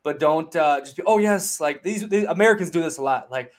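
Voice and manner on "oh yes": higher, overly eager "oh yes"; mock eager salesman. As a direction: play a fawning local hustler. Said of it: "these guys are masters at selling".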